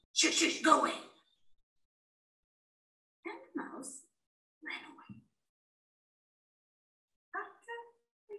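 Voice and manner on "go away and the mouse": plain reading voice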